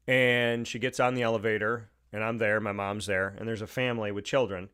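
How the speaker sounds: normal talking voice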